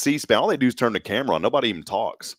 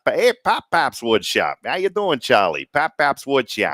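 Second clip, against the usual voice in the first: drawn-out mock Connecticut accent; hammed-up Northeastern hey-buddy greeting; 4 semitones higher, 3 dB louder, slower than usual. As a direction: call out in a put-on Connecticut accent. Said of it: "from Connecticut".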